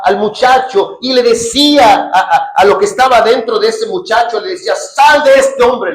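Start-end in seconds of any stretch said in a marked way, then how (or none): 4.8-5.9 loud, slow rebuke; commanding. Acted. Jesus ordering the demon out, loud and slow. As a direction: cast it out with slow authority. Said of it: "le decía Jesús".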